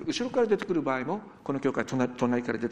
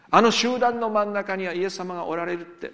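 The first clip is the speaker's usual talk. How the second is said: ordinary delivery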